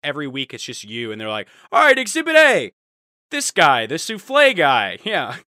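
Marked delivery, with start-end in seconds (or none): 1.7-5.0 FBI instructor. Retold or imitated. FBI instructor presenting a case.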